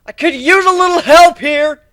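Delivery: loud, high strained call; calling for help. Acted. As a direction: shout for backup across the fight.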